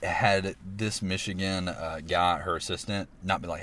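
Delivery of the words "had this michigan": ordinary delivery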